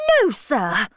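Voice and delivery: mildly indignant exclamation; a touch of protest; crying out. A narrator's reading. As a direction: cut him off with a firm refusal. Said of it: "cried Emma".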